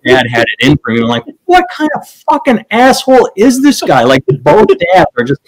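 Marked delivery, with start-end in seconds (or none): 1.5-4.0 high outraged disbelief. Retold or imitated. Retold outrage.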